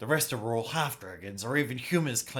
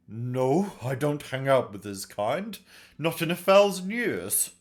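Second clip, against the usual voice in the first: louder, emphatic refusal; indignant denial; 3 semitones higher, 7 dB louder, slower than usual. Acted. Master Toe denying he knows the bandit, indignant. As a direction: deny it hotly, rising at the end.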